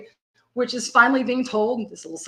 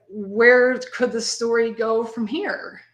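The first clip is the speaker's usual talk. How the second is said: ordinary delivery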